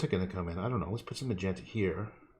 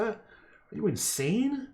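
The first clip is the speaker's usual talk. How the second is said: mock outrage; 11 semitones higher, much slower than usual. Mock outrage at own choice.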